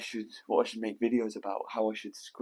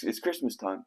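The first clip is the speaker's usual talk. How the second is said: ordinary talk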